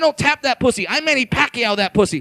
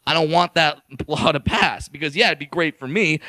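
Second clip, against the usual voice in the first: ordinary talk, nothing marked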